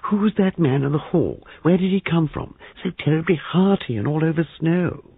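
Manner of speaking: very high, excited hushed voice; high, penetrating stage whisper. A narrator's reading. Young man bursting in about the stranger, high and excited. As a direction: pitch it high and piercing, excitedly. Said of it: "saying in a high, penetrating whisper".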